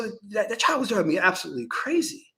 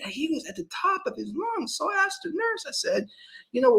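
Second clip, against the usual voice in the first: usual speaking voice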